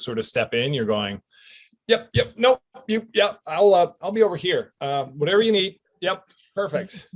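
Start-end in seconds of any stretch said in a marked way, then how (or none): 1.9-7.1 high, eager-to-please yes-man voice; the meek new guy on set, pitched up; timid newcomer. Retold or imitated. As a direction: nervously agree to everything. Newcomer deferring on big set.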